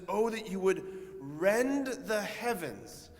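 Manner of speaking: high, loud, drawn-out plea to heaven; Isaiah. Acted. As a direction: cry out slowly with prophetic longing. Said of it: "the O of Isaiah when he says".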